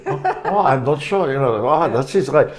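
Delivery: put-on light Austrian German accent, laughing; lightly imitated Austrian German accent. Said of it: "Nick sort of put on a German accent".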